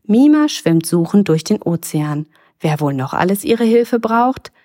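ordinary delivery, nothing marked

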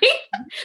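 quick high giggle; short giggle. Real, not acted. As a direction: laugh off the awful saying. Laughs at awful saying.

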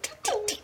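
tiny, high, soft tee-tee-tee; light high patter; teeny tiny steps. Retold or imitated. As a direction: imitate dainty baby steps with little squeaks. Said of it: "like, teeny tiny baby steps".

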